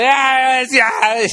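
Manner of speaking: put-on loud, high sloppy drunk "Yeah, yeah"; drunk guy. Wasted stranger butting into a conversation, loud and sloppy. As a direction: slur it like a wasted fan butting in. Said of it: "And they say".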